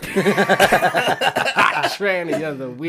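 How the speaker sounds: big, slow radio announcer call. Acted. Host announces the weekly segment in a big radio voice.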